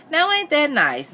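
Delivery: high, slow, syrupy sweetness; honeyed. A narrator's reading. Missus Moss approving Richard's offer, honeyed. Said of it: "in a honey-eyed tone".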